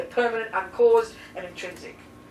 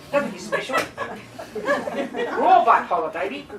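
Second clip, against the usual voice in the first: laughing tease. Real, not acted. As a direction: laugh through it while teasing Sean. Teasing student, laughing.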